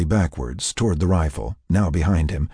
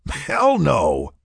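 amused, higher-pitched denial; laughing denial; 7 semitones higher than usual. A narrator's reading. Mac answering Sarah's teasing question, laughing denial. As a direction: toss it off with amusement. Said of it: "Mac laughed".

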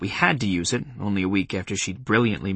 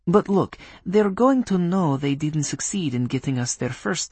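put-on Polish accent; 5 semitones higher than usual. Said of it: "in a Polish accent".

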